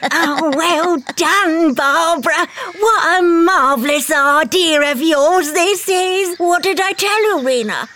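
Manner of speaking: high, slow, gloating witch voice. Acted. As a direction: purr with gleeful witchy praise. Witch gloats over the tree plan.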